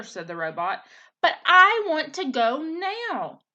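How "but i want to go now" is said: high, loud, slow demand; angry whine. Acted. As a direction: dig in like a defiant teenager. Gosling throws a tantrum.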